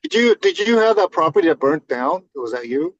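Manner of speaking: ordinary talk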